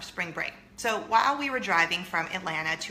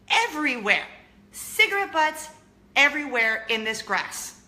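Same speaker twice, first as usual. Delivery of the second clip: loud, high exclaiming at the mess; shocked outcry; 5 semitones higher, 6 dB louder than usual. Retold or imitated. Loud shocked exclaim at cigarette butts everywhere. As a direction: call it out in shock.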